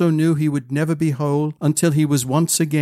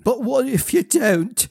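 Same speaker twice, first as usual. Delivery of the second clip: put-on high-pitched worried child; anxious pleading; 10 semitones higher than usual. A narrator's reading. Child fearing the prince won't return, anxious. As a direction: plead for reassurance.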